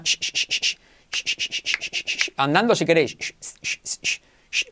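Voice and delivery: voiceless hissed "sh" held out; sh sound demo. Shown as a demonstration. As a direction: push air through for "sh". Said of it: "toda la semana". (sonido "sh" sin voz, exagerado)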